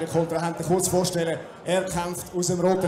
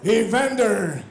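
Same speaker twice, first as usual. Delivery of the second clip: building from called name to a huge, much higher yell; ring announcer; 7 semitones higher, 7 dB louder, slower than usual. Acted. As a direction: stretch it out, then roar the surname. Calling fighter's name to crowd.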